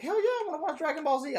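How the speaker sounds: mock giddy child voice; excited kid. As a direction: burst out like a kid woken for cartoons. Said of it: "I was like".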